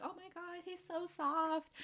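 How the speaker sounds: high, very soft cooing; gushing fan. Retold or imitated. Everybody on Twitter gushing over him, cooing imitation.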